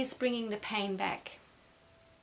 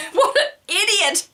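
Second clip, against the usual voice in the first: hammed-up yell, much higher and louder, half laughing; laughing mock fury at her husband; mock outburst; 12 semitones higher, 13 dB louder than usual. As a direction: blurt it out in mock rage. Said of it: "my first reaction is".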